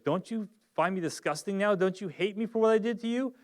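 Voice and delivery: a wife begging, sure she is unwanted; pleading in shame. Retold or imitated. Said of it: "And it's like".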